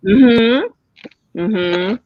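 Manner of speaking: talking while crunching food. Real, not acted. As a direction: mouth full, crunching chips. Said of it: "So sorry I'm crunching".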